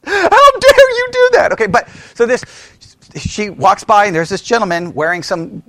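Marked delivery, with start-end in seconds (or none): 0.0-1.5 high-pitched indignant cry; mock outrage. Pastor mocking mask objectors, hammed-up outrage. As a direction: exaggerate the scandalized protest.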